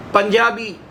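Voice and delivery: usual speaking voice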